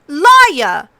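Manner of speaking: put-on furious child yelling liar; yelled. A narrator's reading. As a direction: scream the accusation. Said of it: "Arya yelled".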